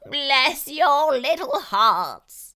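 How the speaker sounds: grateful fox crying out. A narrator's reading. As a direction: fall to his knees begging. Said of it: "he cried".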